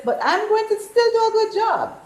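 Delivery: ordinary talk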